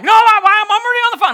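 very high, loud panicked protest; hysterical wife. Retold or imitated. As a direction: imitate a shrieking, frantic wife. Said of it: "You're hysterical right now".

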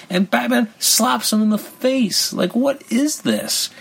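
high-pitched, drawn-out incredulity; exasperated disbelief. Real, not acted. Reviewer appalled at Batman slapping the boy, exasperated disbelief. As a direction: stretch the words out high, in disbelief.

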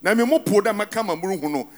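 usual speaking voice

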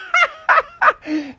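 high mocking laughter; gloating laugh. Froggy gloating over the kittens, high laugh. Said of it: "he started laughing and laughing".

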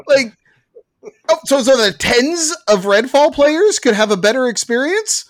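high, loud, furious ranting; heated rant. Real, not acted. Gamer rants to podcast friends about a disappointing game, high and loud. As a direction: let the outrage climb as you vent. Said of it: "your voice goes up two octaves in your rant".

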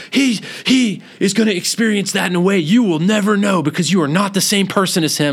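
high, loud mock tirade; retold screaming. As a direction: imitate the outraged daughter lecturing at full tilt. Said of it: "she literally starts screaming at me".

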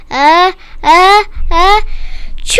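big "uh, uh, uh, chew!" sneeze; loud build-up to a drawn-out sneeze; acted sneeze. Child telling a story about a bear, acting out a big sneeze.

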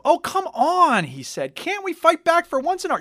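high, loud complaining; whiny protest. Acted. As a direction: plead to fight back.

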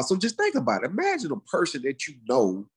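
ordinary delivery

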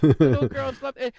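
high, loud, gushing mom voice; proud mother selling the toy; mom impression. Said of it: "I love your impression of your mom".

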